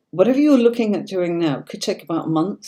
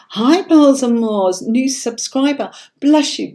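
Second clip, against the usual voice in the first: excited greeting; 8 semitones higher, 7 dB louder than usual. Real, not acted. Greeting a new subscriber.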